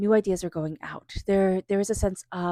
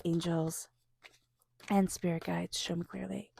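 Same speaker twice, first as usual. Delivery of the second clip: softer, murmured plea to the guides; quiet invocation; 2 semitones lower, 7 dB quieter than usual. Acted. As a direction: murmur the invocation under the breath.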